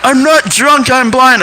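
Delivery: high-pitched mock indignation; indignant protest. Blind man protesting to a fake cop, high indignant voice.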